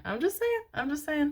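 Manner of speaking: playful high-pitched tease; high squeaky voice. Pretend. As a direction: pop way up high on saying. Playful voices on repeated phrase.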